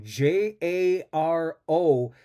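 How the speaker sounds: slow letter-by-letter spelling. Shown as a demonstration. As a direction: spell each letter slowly. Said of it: "It's Yarrow".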